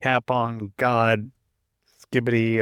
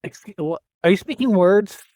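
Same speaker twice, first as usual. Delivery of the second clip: louder, high-pitched incredulous question; incredulous mock outburst; mock bafflement; 6 semitones higher, 5 dB louder than usual. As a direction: act utterly baffled by the slang, rising loud. Acted baffled reaction to office slang.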